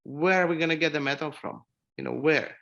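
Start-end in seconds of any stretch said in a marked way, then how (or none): none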